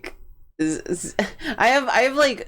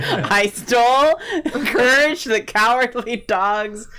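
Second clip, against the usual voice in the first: laughing; 7 semitones higher, 4 dB louder, slower than usual. Real, not acted. Laughing while claiming identity.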